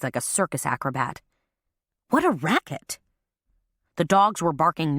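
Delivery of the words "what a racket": Ava's voice. A narrator's reading. Ava complaining about barking dogs.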